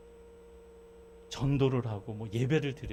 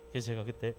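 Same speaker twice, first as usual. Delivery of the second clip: normal talking voice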